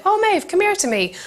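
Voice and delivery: high, lilting friendly call; sing-song call. Retold or imitated. Someone calling Maeve over, high and sing-song. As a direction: call her over brightly. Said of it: "even if someone's like".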